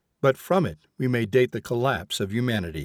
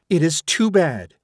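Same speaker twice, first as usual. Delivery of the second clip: louder, higher complaint; exclaiming; 5 semitones higher, 5 dB louder than usual. A narrator's reading. Woman complains to a friend, exclaiming. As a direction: burst out in frustration. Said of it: "she exclaimed to another of her friends".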